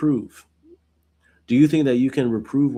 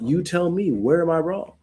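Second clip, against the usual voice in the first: pitched higher, a sarcastic challenge; almost sarcastic; 5 semitones higher than usual. Retold or imitated. Job to his accusing friend, a sarcastic higher challenge. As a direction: dare him to name the wrong. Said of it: "Almost sarcastically".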